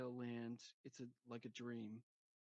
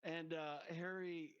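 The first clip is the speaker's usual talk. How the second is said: ordinary talk, nothing marked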